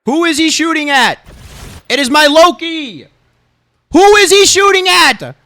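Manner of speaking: high, slow, loud whiny protest; whiny outrage. Retold or imitated. Streamer replaying the fight, mock-wailing that his Loki is being shot. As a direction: wail it like nobody cares about your Loki. Exasperated mock of teammates' plight.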